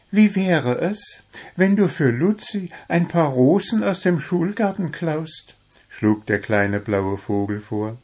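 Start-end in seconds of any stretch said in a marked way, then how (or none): 0.1-5.5 high, suggestive bird voice; little blue bird. Acted. Little bird proposes stealing roses for Luzi, coaxing. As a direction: pitch the proposal high, birdlike.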